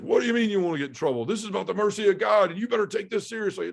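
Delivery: mock furious priest, fast and high; angry priest. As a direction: tear into him like an outraged priest. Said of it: "he loses it".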